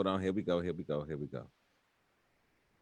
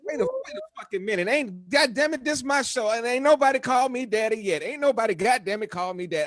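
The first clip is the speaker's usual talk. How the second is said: high, loud mock indignation; hammed-up outrage, high and loud; 8 semitones higher, 3 dB louder than usual. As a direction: play up being offended.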